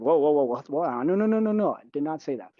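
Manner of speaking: mock-alarmed, pitch jumping on the repeated no; retold startled protest. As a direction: imitate yourself hurriedly backing off a misunderstanding. Retells protest at being misunderstood.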